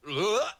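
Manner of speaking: high, loud effortful grunt; surfer grunt. Acted. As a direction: grunt as he wipes out. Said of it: "he goes".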